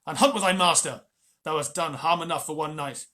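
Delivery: loud furious dismissal; angry shout. Acted. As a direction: throw him out in a rage. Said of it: "snapped Father Wolf".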